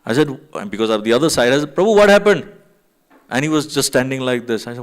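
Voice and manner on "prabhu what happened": raised, louder call across the room; alarmed call. Retold or imitated. Calling to a frozen devotee across the room, alarmed shout. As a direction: call out to him, startled and urgent.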